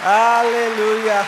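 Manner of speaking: drawn-out shouted aleluya; slow, full-voiced shout of praise; loud hallelujah. Real, not acted. Preacher rousing the congregation with a long, loud shout.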